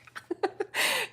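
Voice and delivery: high breathy giggle; real giggle at her own story; high, airy burst of laughter. Real, not acted.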